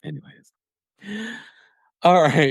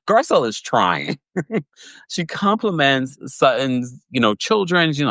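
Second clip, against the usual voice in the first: ordinary talk, nothing marked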